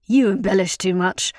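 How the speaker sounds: a touch of testy bickering; spat back. A narrator's reading. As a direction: throw the accusation back at a rival. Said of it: "Otter spat back".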